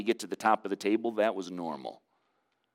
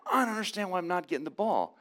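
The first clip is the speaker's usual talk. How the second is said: put-on whiny teenager; 9 semitones higher than usual. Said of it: "if it was Owen, who's sixteen".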